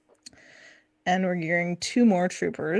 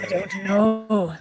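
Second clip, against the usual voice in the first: slow, drawn-out pirate voice; pirate drifting toward Scottish; slower than usual. Pretend. Guest answering a pirate question, slow mock pirate. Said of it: "I like the way T Money, your pirate, turns into a Scottish voice".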